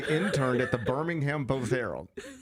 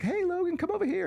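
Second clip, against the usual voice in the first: high sing-song call across the room; calling out to a kid. Retold or imitated. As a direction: call the child over, pitched way up.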